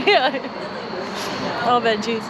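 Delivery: usual speaking voice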